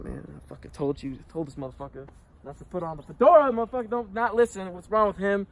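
a hint of a fed-up inner angel; good conscience voice. His good side scolding him, lightly voiced exasperation. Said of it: "Good Dante's just like".

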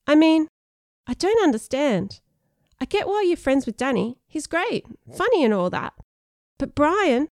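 high-pitched, urgent outburst; blurted out. Acted. Sean confronting a friend about new friends, blurting. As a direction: burst in high and let it tumble out. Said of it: "Sean blurted out in a rush".

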